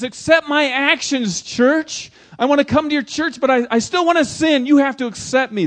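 high, loud, whiny demand; voicing the world. Pastor voicing the world begging the church, high whiny demand.

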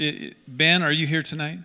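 normal talking voice

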